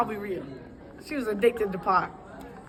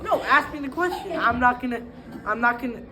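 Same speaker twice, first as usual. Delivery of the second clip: usual speaking voice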